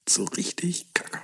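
soft savoring whisper. Real, not acted. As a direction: breathe it out, savoring. Repeats phrase, whispered.

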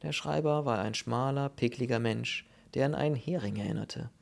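ordinary delivery, nothing marked

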